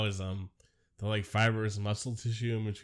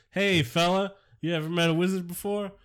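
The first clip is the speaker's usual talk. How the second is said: higher-pitched, louder street greeting; swaggering wizard man; 8 semitones higher, 3 dB louder than usual. Acted. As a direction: play a wizard accosting a passer-by, no London accent. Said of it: "this metropolitan sort of wizard man comes up".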